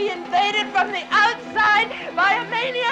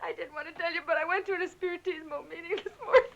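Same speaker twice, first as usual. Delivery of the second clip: soft, crying admission; hushed and tearful; tearful confession; 4 semitones lower, 9 dB quieter, faster than usual. Acted. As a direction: confess it quietly through tears. Tearfully confessing what she has done.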